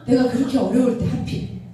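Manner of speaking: ordinary talk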